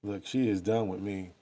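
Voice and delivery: ordinary delivery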